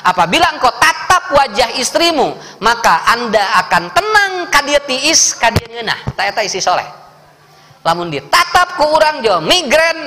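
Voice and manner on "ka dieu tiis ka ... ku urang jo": ordinary talk, nothing marked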